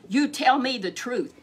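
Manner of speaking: loud angry demand. Acted. Ahab demanding truth from Micaiah, angry and loud. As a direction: bark it at the prophet.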